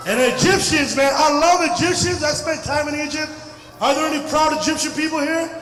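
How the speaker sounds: normal talking voice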